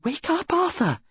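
high, insistent wake-up call; urgent. Acted. Wife urgently waking her sleeping husband, high and pressing. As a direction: rouse him, pitched high and urgent.

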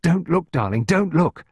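rising, insistent imploring; begging. A narrator's reading. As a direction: beg a child to look away. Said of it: "she begged".

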